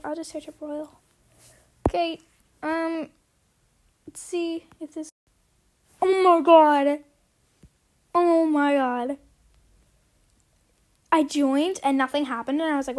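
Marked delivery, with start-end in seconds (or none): 6.0-9.2 shocked. Real, not acted. Shocked at instant ban.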